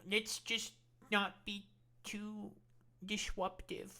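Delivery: mock timid voice. Mocking timid Democrats.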